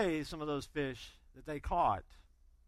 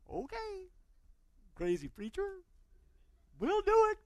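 much higher, doubtful go-along; skeptical Peter; 13 semitones higher than usual. Retold or imitated. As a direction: humor the crazy preacher. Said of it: "But Peter, bless his heart".

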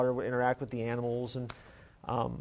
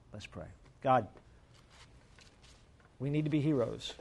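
prayer; 3 semitones lower, 4 dB quieter than usual. Acted. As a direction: pray quietly over the congregation. Closing prayer.

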